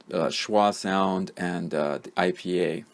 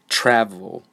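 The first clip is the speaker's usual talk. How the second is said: "Travel" with a strong first syllable and a weak second; first-syllable stress; 4 dB louder than usual. Shown as a demonstration. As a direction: punch the start of "Travel", let the end fall away. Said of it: "first syllable is the one that is stressed".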